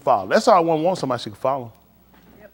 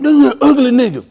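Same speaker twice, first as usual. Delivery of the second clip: mocking exclaim; 10 semitones higher, 7 dB louder than usual. Women mocking an ugly guy.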